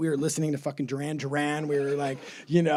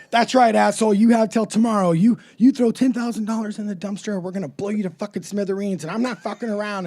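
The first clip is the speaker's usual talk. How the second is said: raised, forceful mock-tough voice; menacing threat; 5 semitones higher, 7 dB louder than usual. Kid prank caller threatening a man for money, mock menacing. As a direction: act the kid extortionist laying down terms.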